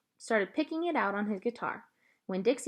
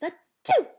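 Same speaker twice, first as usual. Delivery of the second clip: loud mock sneeze; sneeze sound; 9 semitones higher, 10 dB louder than usual. A narrator's reading. Girl imitating the dog's sneeze, loud mock sneeze. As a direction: act out a sneeze, high and loud. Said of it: "I went".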